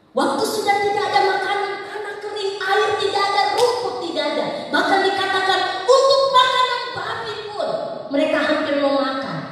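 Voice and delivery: high, loud sermon cry; impassioned preaching. Real, not acted. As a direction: pile up each hardship at full force. Preaching at full force.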